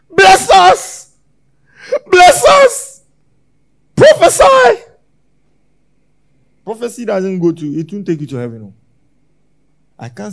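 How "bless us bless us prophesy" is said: high, loud pleading cries from the crowd; high shouted plea for blessing; mock pleading crowd. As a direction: cry out like a begging congregation. Congregation begging for blessing, mimicked.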